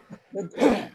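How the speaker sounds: chesty cough clearing the throat; a short rough cough. Real, not acted. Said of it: "I'm coughing".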